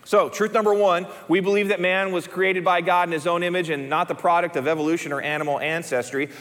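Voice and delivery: ordinary delivery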